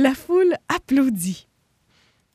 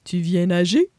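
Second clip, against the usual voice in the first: deep hippo voice; 5 semitones lower, 5 dB louder than usual. Acted. Hippo character voice.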